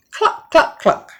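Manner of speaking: high hen cluck. A narrator's reading.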